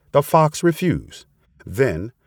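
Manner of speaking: ordinary delivery, nothing marked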